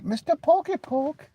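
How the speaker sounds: squeaky cartoon name-calling; silly high voice. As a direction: stretch it out like a cartoon.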